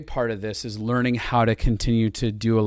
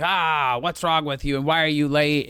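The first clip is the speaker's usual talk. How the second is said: whiny nagging wife; 3 semitones higher than usual. Retold or imitated. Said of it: "the wife's just whining and moaning".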